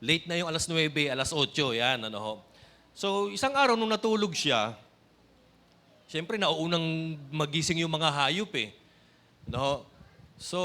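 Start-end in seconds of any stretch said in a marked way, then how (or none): none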